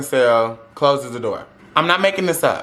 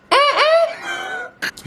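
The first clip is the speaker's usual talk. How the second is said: squealed mm-mm. Retold or imitated. Shocked no one told him.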